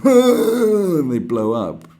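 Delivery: loud slow laugh through the words; giggling delight. Retold or imitated. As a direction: crack up at the stupid stunt. Laughing at fireworks guy.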